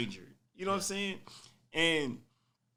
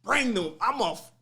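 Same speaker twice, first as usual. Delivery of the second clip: loud challenge; 2 semitones higher, 8 dB louder, slower than usual. Retold or imitated. Challenging mother's threat.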